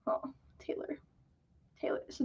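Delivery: hushed fond whisper; soft unvoiced whisper of a name. Real, not acted. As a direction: breathe it out under your breath. Fond aside about Taylor's noodle.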